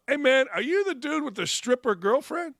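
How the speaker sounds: imitating a recruit. Voicing a recruit asking coach.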